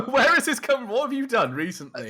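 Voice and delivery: loud, high question through laughter; amused, incredulous burst; laughing disbelief. Real, not acted. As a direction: ask it while cracking up. Amused at the other's rant.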